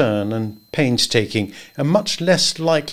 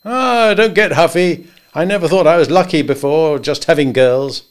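higher, louder, bluff and jovial; higher voiced good old boy; hearty Henry; 5 semitones higher, 5 dB louder than usual. A narrator's reading. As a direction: boom friendly advice across the desk. Henry placating Adam.

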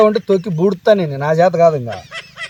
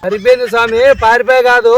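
loud correction; 5 semitones higher, 5 dB louder than usual. Acted. Correcting the deaf uncle loudly.

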